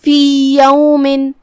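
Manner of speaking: فی یومن very slow, both ya said clearly and a nasal hidden n. Shown as a demonstration. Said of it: "یہ دونوں یا کو ہم ظاہر کریں گے". (دونوں یا کو ظاہر کر کے)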